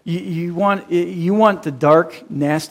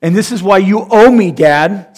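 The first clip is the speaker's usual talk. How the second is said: louder, indignant demand; ticked-off demand; 4 semitones higher, 8 dB louder than usual. Retold or imitated. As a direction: whine it like you're owed. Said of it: "practicing the "I'm ticked" speech".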